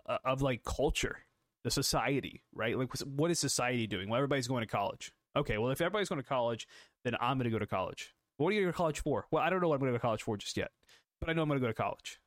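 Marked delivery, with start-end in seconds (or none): 4.1-12.2 quick-fire imitation of going along with the crowd; rushed mock reasoning. Podcaster mimicking students following society into college, rushed mock reasoning. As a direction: rattle it off quickly, like rote logic.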